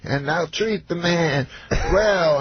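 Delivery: mimicked comic, high and unhurried; Bill Cosby. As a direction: stretch the grand promise out. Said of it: "He says".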